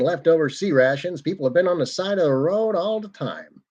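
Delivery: hammed-up old-timey broadcast patter; old-timey broadcaster. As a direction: sign off like a vintage broadcaster, pitch swooping up. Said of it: "some of that old-timey broadcaster voice".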